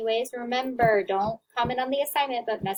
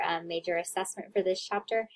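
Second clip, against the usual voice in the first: ordinary talk, nothing marked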